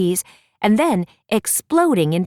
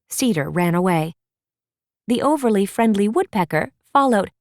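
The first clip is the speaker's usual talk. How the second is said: ordinary delivery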